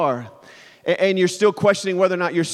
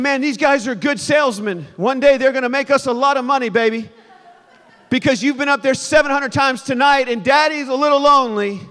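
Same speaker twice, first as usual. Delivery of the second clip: higher, mock-weary parent aside; tired dad joking; 7 semitones higher, 3 dB louder than usual. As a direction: joke with your wife, weary and wry. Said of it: "and you're thinking".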